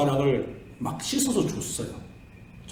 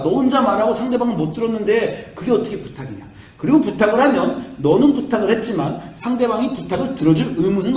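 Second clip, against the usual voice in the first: lecturing; 5 semitones higher, 5 dB louder than usual. Retold or imitated. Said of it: "설교하는 사람이 설교를 해".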